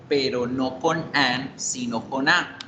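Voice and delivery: usual speaking voice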